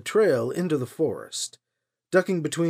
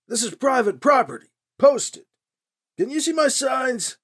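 calling from afar; 8 semitones higher than usual. A narrator's reading. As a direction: holler it up the trail, irritated. Old man shouting up trail.

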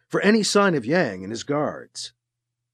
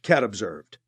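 plain reading voice

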